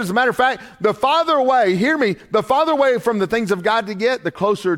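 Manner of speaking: high, ringing preacher's cadence, each phrase pushed up; preaching at full force. Acted. Pastor warning the church about drifting from God, preaching hard. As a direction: drive the point home to the congregation.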